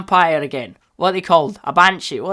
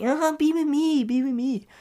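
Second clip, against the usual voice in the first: hammed-up lovelorn pleading, pitched way up; pleading admirer; 10 semitones higher than usual. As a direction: coo it high, teasing the lovesick. Mimics characters pleading for romance.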